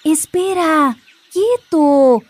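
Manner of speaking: ordinary delivery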